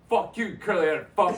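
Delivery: loud, high imitated cursing; hammed-up angry jeer; mock insult. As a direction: hurl the retold insult through laughter. Acting out coworker's insult.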